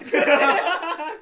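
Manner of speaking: loud high laugh burst; loud burst of laughter. Real, not acted. Laughing at girlfriend's remark. (爆笑)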